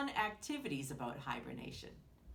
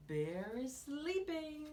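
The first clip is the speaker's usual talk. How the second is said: usual speaking voice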